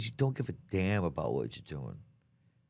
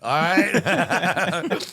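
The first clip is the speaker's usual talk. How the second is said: laughing; 9 semitones higher, 6 dB louder than usual. Real, not acted. Laughing through cocaine joke.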